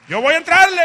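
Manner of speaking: loud, high battle cry; preacher shouting as Gedeón to the Lord; bold shout. Acted. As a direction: yell it like charging into battle. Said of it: "Y Gedeón dijo".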